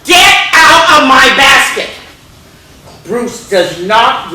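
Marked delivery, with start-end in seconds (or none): 0.0-1.9 loud higher yell at the moose; angry yell at the moose; angry shout. Acted. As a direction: shout him off the basket. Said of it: "She goes".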